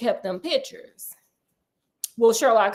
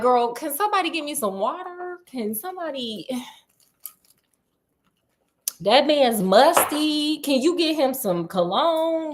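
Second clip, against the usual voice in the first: disgusted sass; 4 semitones higher than usual. Retold or imitated. Herself in Irene's place, sassy disgust. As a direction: complain he smells musty. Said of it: "I would have been like".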